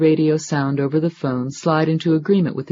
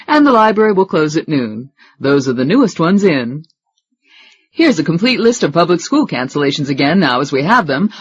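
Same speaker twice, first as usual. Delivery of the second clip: brisk, bright DJ read, a bit louder; radio announcer; 2 semitones higher, 6 dB louder than usual. Acted. Radio host reading school closings on air, upbeat announcer.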